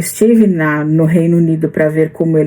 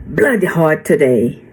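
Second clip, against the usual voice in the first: Australian accent. Shown as a demonstration. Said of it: "eles usam muito o blood".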